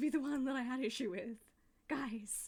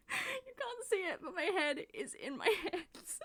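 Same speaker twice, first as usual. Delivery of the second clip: slow, laughing through the words; 9 semitones higher, slower than usual. Real, not acted. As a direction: giggle helplessly while speaking. Embarrassed at streaming muted.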